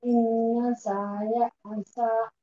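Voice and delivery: slow, measured Quranic recitation; student begins a slow recitation. Shown as a demonstration. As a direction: begin reciting the verse carefully. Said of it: "बिस्मिल्लाहिर्रहमानिर्रहीम".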